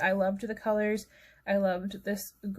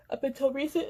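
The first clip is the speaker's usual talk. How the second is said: yawning; 5 semitones higher, faster than usual. Real, not acted. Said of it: "sorry for yawning again".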